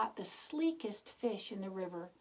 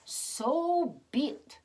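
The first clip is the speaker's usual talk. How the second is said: slow, higher put-on voice for the youth; solemn youth, slow and high; voiced youth; 8 semitones higher, 8 dB louder, much slower than usual. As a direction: draw it out as the mysterious youth. Youth speaks in story.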